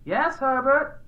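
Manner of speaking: high, distant-sounding wife voice; wife answering a call. A narrator's reading. Wife replying to her husband's call, calling back lightly. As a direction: answer from another room, high and questioning.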